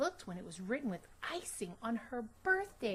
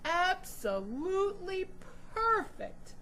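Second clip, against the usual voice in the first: high, louder, emphatic praise; reassuring father; 9 semitones higher, 6 dB louder than usual. Acted. Father reassuring his daughter her name is perfect, emphatic. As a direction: convince her lovingly.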